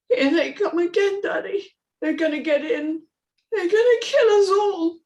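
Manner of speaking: put-on high child's voice rising in fear; frightened little girl. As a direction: dread as the siren wails. Amy's voice.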